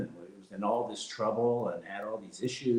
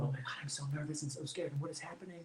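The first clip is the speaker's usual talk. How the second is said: soft, hushed imitation of a scared old lady; a light take on a frightened grandma; mock nervous grandma; 3 semitones higher, 13 dB quieter, faster than usual. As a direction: quietly voice her fear. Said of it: "she's clutching my arm going".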